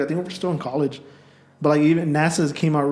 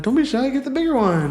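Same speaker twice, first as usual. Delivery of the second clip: much higher mock alien voice; goofy alien; 12 semitones higher, 3 dB louder than usual. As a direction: play the cheerful alien prober. Voicing the probing alien.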